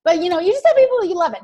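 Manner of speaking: usual speaking voice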